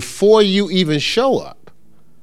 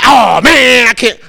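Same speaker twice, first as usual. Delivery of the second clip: loud, high exasperated groan; mock TV yelling; 8 semitones higher, 7 dB louder, slower than usual. Imitating a fan yelling at the TV, loud exasperation. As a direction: wail at the game like a fan. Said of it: "I'm not yelling at the T V".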